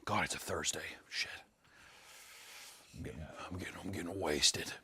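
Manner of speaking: whispered aside; whispering. Real, not acted. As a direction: whisper it under your breath. Muttering to herself aside.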